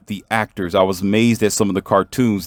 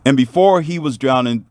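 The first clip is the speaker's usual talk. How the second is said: ordinary delivery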